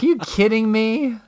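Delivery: high, disbelieving question; can't believe what he's hearing; incredulous. Retold or imitated. Retelling his reply to the neighbors, incredulous and high. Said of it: "I'm like".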